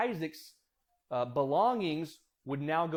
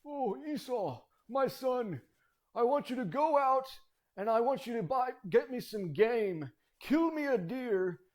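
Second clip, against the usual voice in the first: put-on old man's voice; 6 semitones higher than usual. Dying Isaac sending his son hunting, old man's voice. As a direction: put on a high, aged voice. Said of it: "I might use his old voice".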